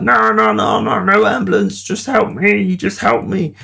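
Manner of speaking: begging not to make a fuss; pleading friend. Retold or imitated.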